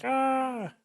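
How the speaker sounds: a sudden high, bright "Ah" of delight; excited gasp. Retold or imitated. Excited kid reacting to caps.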